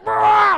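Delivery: loud lip-trill shout; loud motorboat brrr. Retold or imitated. Comic bouncing on the tube, loud brrr. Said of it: "So I'm like".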